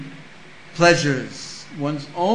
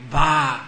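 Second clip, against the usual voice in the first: sheep bleat; 2 semitones lower, 5 dB louder than usual. Retold or imitated. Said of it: "if it's done with sheep".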